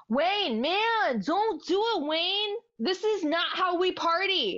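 mock yell, high-pitched and pleading; Garth. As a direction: plead in a high, put-on dude voice. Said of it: "So he yells at him".

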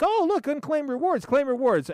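playful "oh look" eagerness; mock excitement. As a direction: act out spotting a prize. Acts out finding rewards.

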